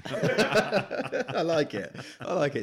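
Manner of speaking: giggling through the words; laughing while talking. Real, not acted. Laughing after the joke.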